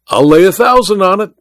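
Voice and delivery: boastful cry offering a wager; confident cry. A narrator's reading. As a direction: stake the bet with bravado. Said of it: "cried Welton".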